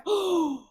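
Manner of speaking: high audible gasp; shocked gasp. Real, not acted. Streamer learning about the man's wife, shocked gasp. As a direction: suck in breath in shock.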